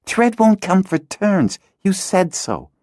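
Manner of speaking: put-on high, slow girl's voice, irritated; a girl replies irritably to her friend. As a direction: answer with irritation. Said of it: "Hala replied irritably".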